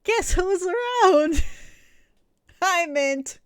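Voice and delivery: very loud, high, drawn-out cheer; hammed-up excitement. Announcing that Mint is around, hammed-up excited cheering. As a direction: whoop it up with theatrical glee.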